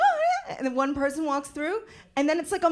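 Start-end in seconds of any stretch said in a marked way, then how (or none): 0.0-0.6 very high, laughing "oh, yeah"; polite door holder. Retold or imitated. Holding the door for strangers, high polite squeak. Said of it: "you're like".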